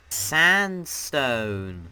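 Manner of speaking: loud, drawn-out title; announcer call. Real, not acted. Host naming the next dimension for viewers, loud stretched announcement. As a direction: call it out big and long.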